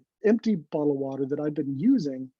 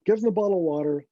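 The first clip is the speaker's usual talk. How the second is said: ordinary delivery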